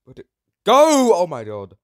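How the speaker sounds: high, loud frantic question; shrill panic; panicked shout. Real, not acted. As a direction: yell searching for it. Lost the soul.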